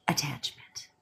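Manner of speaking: just a whisper, breathy and low; hushed aside; whispered word. Real, not acted. Emphatic whispered keyword.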